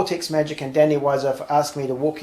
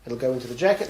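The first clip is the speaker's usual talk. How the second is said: ordinary talk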